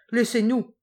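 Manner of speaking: loud, furious dismissal; angry growl. A narrator's reading. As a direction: throw them out with a roar. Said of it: "gronda Garcia".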